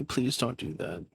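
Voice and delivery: ordinary delivery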